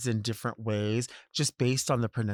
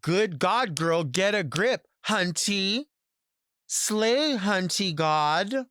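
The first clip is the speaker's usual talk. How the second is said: higher, mock catchphrase voice; showy, high-pitched drag-phrase imitation; performative drag slang; 6 semitones higher, slower than usual. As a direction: parody that girl shouting out slogans. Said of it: "that's a little bit performative".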